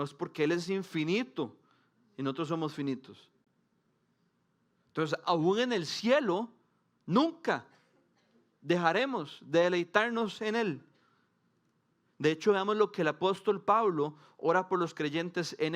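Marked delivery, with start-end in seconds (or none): 4.9-10.9 nunca hit high and loud, the rest slow; emphatic preaching. Acted. Preacher driving home eternity to the congregation, slow, loud, nunca stressed. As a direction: build to the point and land hard on nunca.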